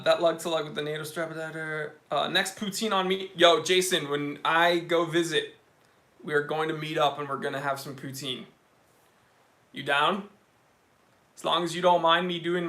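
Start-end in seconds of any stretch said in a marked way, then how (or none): none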